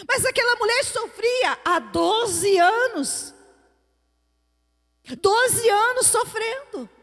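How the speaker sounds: high, insistent exhortation; fervent preaching. Real, not acted. Preacher urging those praying for someone not to give up, fervent.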